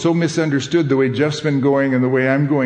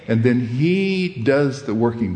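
ordinary talk, nothing marked

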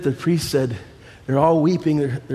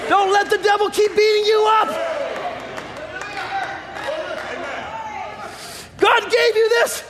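preaching at full force. Acted. Preacher exhorts the congregation.

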